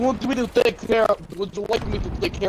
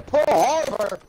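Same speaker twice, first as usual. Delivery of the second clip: higher-pitched cry of mock panic; mock alarm; 4 semitones higher, 3 dB louder, slower than usual. Player joking to teammates about the bombing, mock-alarmed shout. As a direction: call it out like an air-raid warning.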